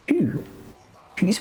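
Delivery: high-pitched incredulous question. Real, not acted. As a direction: jump high in disbelief. Rhetorical challenge to protection excuse.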